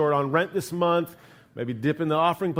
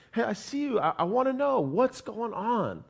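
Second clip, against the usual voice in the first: gentle and tender; 7 semitones higher than usual. Acted. God softly inviting the listener.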